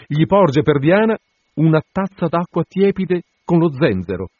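plain reading voice

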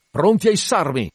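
high, loud order; shouted. Acted. Ahab ordering the crew to hoist him aloft, loud shout. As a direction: call out to be hoisted up. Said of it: "Gridò Acab".

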